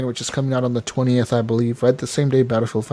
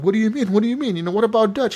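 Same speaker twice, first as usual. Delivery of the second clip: higher-pitched retold pleading; mock bewildered protest; 7 semitones higher, faster than usual. Strauss protesting to Arthur at being kicked out, mock flustered. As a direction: imitate a flustered man protesting.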